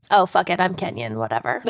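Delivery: tossed-off, quick and low; careless mumble. Real, not acted. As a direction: mumble it, not caring. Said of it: "I love that's how you say it every time".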